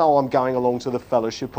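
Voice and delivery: low, quick, offhand mimicry; glib churchgoer. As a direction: toss it off glibly, low and fast. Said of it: "we use it very glibly".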